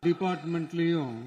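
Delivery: ordinary delivery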